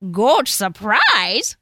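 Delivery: loud, slow troll voice; intrigued troll. A narrator's reading. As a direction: draw it out as a hungry troll's question. Troll repeats the offer.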